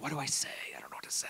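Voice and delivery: whispered, panicky inner voice; anxious whisper. Retold or imitated. A worried person whispers to themself at the table. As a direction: breathe it like a nervous thought. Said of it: "this is where people are like".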